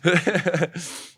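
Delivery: long high laugh; high laughter. Real, not acted. Laughs at own story.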